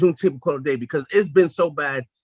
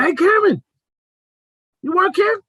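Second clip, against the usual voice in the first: loud, high-pitched challenge; retold yelling out; 13 semitones higher, 5 dB louder than usual. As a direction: call up to her, re-enacting the shout. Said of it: "I'm yelling out".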